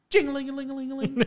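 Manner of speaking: high, fast jingling trill; imitated bell ringing. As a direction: mimic an insistent door bell. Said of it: "just be like".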